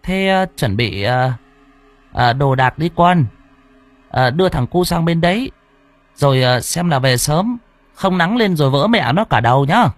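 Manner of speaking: grandma fussing over the family leaving early; halting, fretful old woman; old mother. A narrator's reading. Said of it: "liền hỏi".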